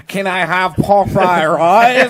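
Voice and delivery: drawn-out, loud imitated Chinese accent; high, hammed-up Chinese accent; mock Chinese accent. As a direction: exaggerate a Chinese-accented takeout order, slowly. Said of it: "there goes that guy that goes".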